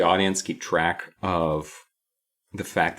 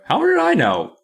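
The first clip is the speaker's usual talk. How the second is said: high, loud incredulous comeback; mock exasperation; 5 dB louder than usual. Reflection fed up with being questioned, mock exasperated. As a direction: toss the question back, voice leaping up.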